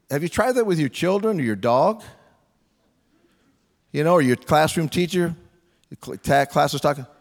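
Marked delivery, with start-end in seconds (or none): none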